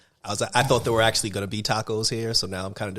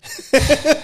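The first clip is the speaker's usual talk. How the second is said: explosive laugh burst; 8 dB louder than usual. Real, not acted. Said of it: "did [name] bust up a gunshot".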